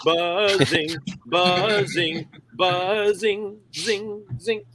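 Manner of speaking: rising buzzy drone; buzzing noise imitation.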